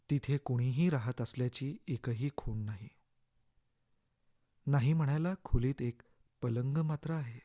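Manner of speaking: ordinary delivery, nothing marked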